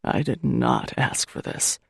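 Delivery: low, slow, grinding words; exhausted man refusing her kindness, slow and grating; grated out. Acted. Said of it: "he grates out".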